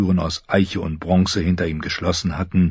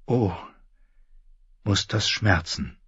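softer inner voice; Sansa's thought; 6 dB quieter than usual. A narrator's reading. Sansa pitying the old knight, soft inner thought. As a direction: think it to yourself softly. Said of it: "dachte Sansa".

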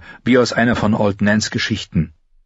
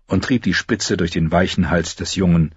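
plain reading voice

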